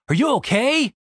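a touch of alarm; concerned cry. Acted. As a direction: check on a fallen friend, voice lifted. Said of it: "cried Randy".

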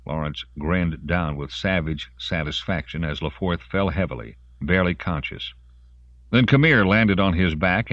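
ordinary delivery

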